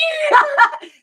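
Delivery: high loud burst of laughter; loud shrieking laugh. Real, not acted.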